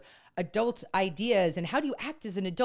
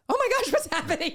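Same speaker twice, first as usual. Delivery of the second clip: higher, alarmed laughing gasp; shocked son; 12 semitones higher, 3 dB louder than usual. Retold or imitated. Son watching his mom break down, shocked. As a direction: react like a teen seeing mom lose it. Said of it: "he was like".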